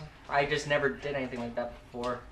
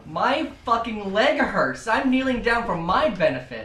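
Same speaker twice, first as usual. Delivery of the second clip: louder, slow, exasperated complaint; 6 semitones higher, 9 dB louder, slower than usual. Acted. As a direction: grumble loudly about a sore leg. Complaining about leg pain.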